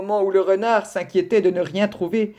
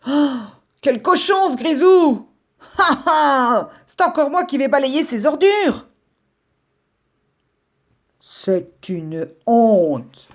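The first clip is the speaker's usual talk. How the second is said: higher, indignant scolding, a mocking ha ha inside; angry grumbling rabbit; 7 semitones higher, 4 dB louder, slower than usual. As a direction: fume about the neighbour's rubbish. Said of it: "Monsieur Brun se fâcha".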